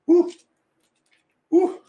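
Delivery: high loud whoop; joyful yell. Real, not acted. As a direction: let out a big celebratory whoop.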